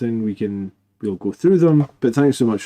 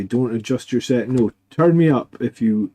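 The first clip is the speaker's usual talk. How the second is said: normal talking voice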